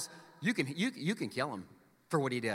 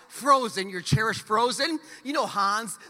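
ordinary talk, nothing marked

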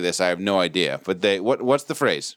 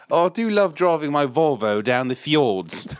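slow, put-on English accent; mock English accent; 2 semitones higher than usual. Viking descendant in a remade movie, mock English accent. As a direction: play a Norwegian character with an English accent. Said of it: "they all have English accents".

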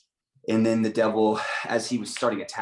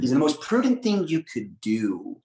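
slow, higher, louder sneer; mocking devil voice; 4 semitones higher, 4 dB louder, slower than usual. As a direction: drag out prudent like a smug tempter. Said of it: "that's like the devil".

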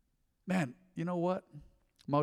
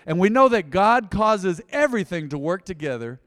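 loud, high proclamation; emphatic scripture preaching; 5 semitones higher, 8 dB louder than usual. Real, not acted. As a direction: ring the verse out over the congregation. Proclaims the verse again.

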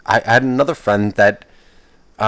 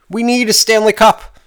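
frustrated outburst; 10 semitones higher, 3 dB louder than usual. Real, not acted. Ranting about the Rangers.

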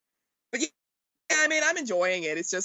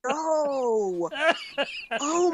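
normal talking voice

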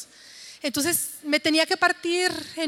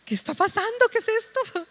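astonished; 7 semitones higher than usual. Retold or imitated. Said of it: "nos quedamos asombrados".

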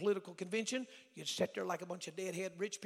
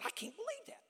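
high, softer, spluttering disbelief, re-enacted; shocked stuttering; 5 semitones higher, 5 dB quieter than usual. Young student crying to his pastor, imitated shocked stutter. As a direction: act out being too shocked to finish, stammering. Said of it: "I was spitting all over myself, stuttering".

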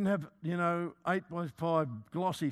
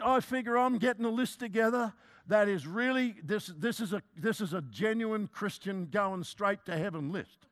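usual speaking voice

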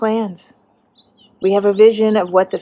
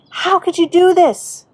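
high, raised retold yell at God; angry yell; 8 semitones higher, 3 dB louder than usual. Preacher recalls turning anger directly at God, raised angry yell. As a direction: cry out in indignant protest, pitched well up. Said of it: "turned my anger directly at God and yelled".

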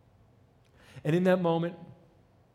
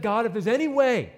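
higher, louder desperate plea; pleading loudly in anguish; Jesus pleading; 5 semitones higher, 6 dB louder than usual. Acted. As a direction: beg God to spare the cross. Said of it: "Jesus said".

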